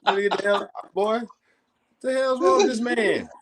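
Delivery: very high, incredulous squeal; high-pitched exasperation. Acted. Man telling A P he takes on everybody, high-pitched exasperation. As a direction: protest in a voice pushed right up high. Comic outrage, warning AP.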